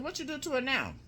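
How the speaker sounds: high-pitched retold confrontation; accusing demand. Aunt confronting the nervous uncle about her niece, accusing. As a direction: demand answers, pitched well up.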